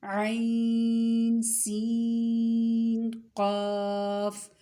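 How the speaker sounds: very slow recitation, long vowels held far beyond normal; teacher showing the big madd, vowels stretched very long. Shown as a demonstration. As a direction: hold the big madd as long as you can. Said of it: "दोनों को एक जितना खींचना है".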